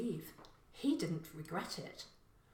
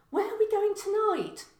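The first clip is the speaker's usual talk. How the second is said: 1 eager son; 13 semitones higher, 9 dB louder than usual. A narrator's reading. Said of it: "Cian asked".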